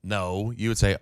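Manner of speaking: ordinary delivery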